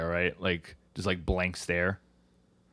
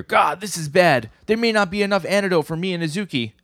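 high, raised mock-panicked voice; hammed-up high-pitched alarm; 10 semitones higher, 4 dB louder than usual. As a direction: act worried and urgent, pitched way up. Acted alarm over poison antidote.

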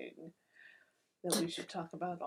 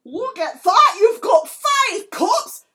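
mock-excited yelling, much higher and louder; screamed tarot reading; 10 semitones higher, 15 dB louder than usual. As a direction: bellow the good news with a laugh breaking in. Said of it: "I will scream a tarot reading".

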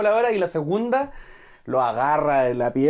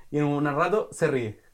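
ordinary talk, nothing marked

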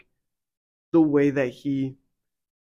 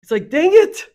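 much higher and louder, fired up; exasperated outburst; 9 dB louder than usual. Real, not acted. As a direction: burst out in frustration. Frustrated outburst at skeptics.

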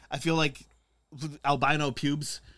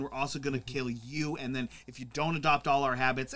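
usual speaking voice

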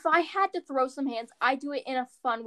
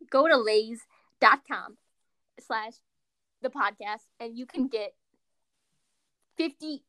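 mock ad read; 5 dB louder than usual.

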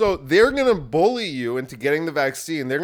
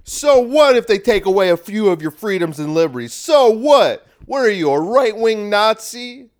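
higher, louder, indignant mock lecture; hammed-up self-righteous scolding; mocking woke scold; 6 semitones higher, 8 dB louder than usual. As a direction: sneer it like a preachy liberal. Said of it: "that's the whites who are going".

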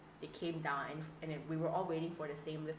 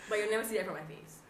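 usual speaking voice

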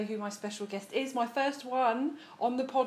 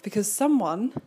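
usual speaking voice

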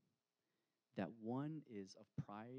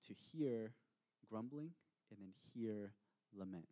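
ordinary talk, nothing marked

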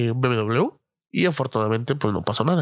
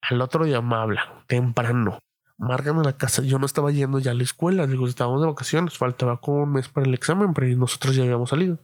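usual speaking voice

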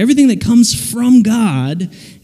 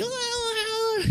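high, wavering cry of mock panic; panicked overcorrecting wail; 13 dB quieter, slower than usual. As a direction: wail softly as if swerving out of control. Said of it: "you're just like".

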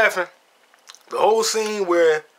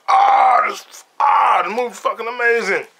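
high, loud, gushing with a cry in the voice; gushing in awe; 7 semitones higher, 4 dB louder, slower than usual. Retold or imitated. Imitating himself in his cinema seat, overwhelmed and gushing. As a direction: act out being blown away by the film. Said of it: "I was just sitting in my chair like".

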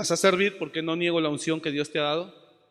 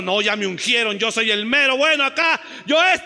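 mock boast, loud and higher; proud boast; 6 semitones higher, 7 dB louder than usual. Preacher voicing a proud David, loud mock boast. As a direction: play the puffed-up anointed one shouting.

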